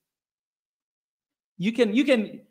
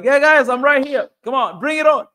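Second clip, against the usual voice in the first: louder and higher, daring them on; defiant call-out; 6 semitones higher, 8 dB louder than usual. Pretend. Daring the Somali commenters in Mogadishu, loud and defiant. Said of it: "I'll be like".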